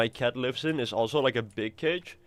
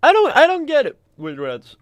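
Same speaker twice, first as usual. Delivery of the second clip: exasperated; 13 semitones higher, 11 dB louder than usual. Real, not acted.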